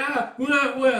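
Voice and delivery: high, loud mock infant wail; imitated baby crying. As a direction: wail like a baby starting to cry. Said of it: "as the baby starts crying".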